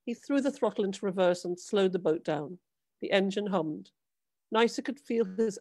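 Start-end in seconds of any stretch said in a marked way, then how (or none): none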